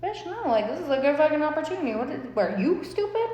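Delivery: high, fast indignant retort; defiant. Retold or imitated. Daughter arguing with mom, high and indignant. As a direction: snap back at mom, fast. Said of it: "And I'm like".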